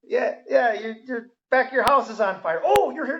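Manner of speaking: high-pitched put-on partygoer voice; mock casual welcome. Partygoer waving firefighters toward the fire, mock casual welcome.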